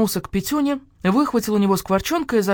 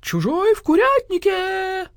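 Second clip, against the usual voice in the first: high, drawn-out alarm cry; crowing scream; 11 semitones higher, slower than usual. A narrator's reading. Rooster warns of the intruder, high crowing scream. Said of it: "завопил Петюня".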